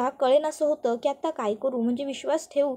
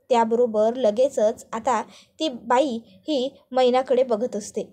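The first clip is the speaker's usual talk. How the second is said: plain reading voice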